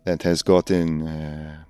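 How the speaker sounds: hammed-up bad French accent of a Paris concierge; a lightly done, cheesy French accent. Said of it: "the worst French accent I've ever done".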